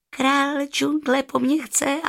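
high, slow jackal wondering to himself; slow, high-voiced disbelief; amazed jackal. A narrator's reading. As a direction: muse in astonishment, pitched high. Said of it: "pomyslel si s údivem šakal".

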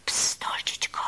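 soft whispered shush; hushing. A narrator's reading. Grandmother hushes her little granddaughter, whispering. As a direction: whisper to quieten an excited child. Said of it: "utišovala Lizetku babička".

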